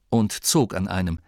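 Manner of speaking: ordinary delivery, nothing marked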